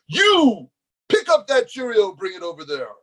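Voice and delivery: mock-bossy high, loud command; screaming at ants. Self-crowned king ordering ants around, mock yelling. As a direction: start high and loud, then fall away. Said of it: "screaming at my ants".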